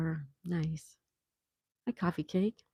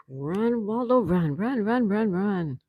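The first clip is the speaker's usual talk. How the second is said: higher, louder run run chant; playful cheering; 4 semitones higher, 4 dB louder, slower than usual. Pretend. Host playfully cheering Waldo on during the search, excited. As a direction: urge Waldo on like a race fan.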